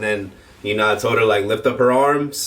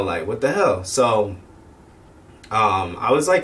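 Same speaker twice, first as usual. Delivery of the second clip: ordinary delivery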